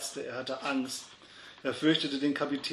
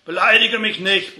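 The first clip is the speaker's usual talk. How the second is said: loud, raised-pitch angry yell; bellowing in a bar fight; bellowed retort; 7 semitones higher, 11 dB louder than usual. A narrator's reading. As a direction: roar back at the insult. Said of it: "brüllte der andere".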